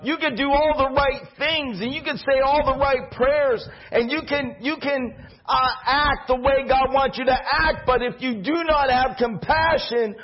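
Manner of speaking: preaching. Acted. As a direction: drive the point home to the church. Preaching climax on compassion.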